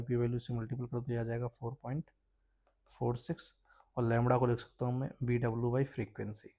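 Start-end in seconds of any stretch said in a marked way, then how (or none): none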